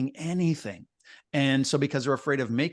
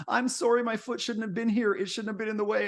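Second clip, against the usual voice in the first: higher, hammed-up gushing apology; over-apologetic Canadian; 9 semitones higher than usual. Canadian whose foot got stepped on, mock higher gushing apology. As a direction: play the Canadian saying sorry over and over. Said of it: "If you step on a Canadian's foot".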